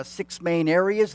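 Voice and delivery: ordinary talk, nothing marked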